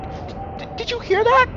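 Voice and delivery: nervous, stuttered high-pitched question. A narrator's reading. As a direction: stammer it out nervously, voice jumping up. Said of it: "asks G D nervously".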